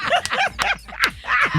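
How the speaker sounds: a burst of laughter shouted out very high. Real, not acted. Host laughing at his own story.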